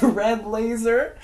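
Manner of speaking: ordinary talk, nothing marked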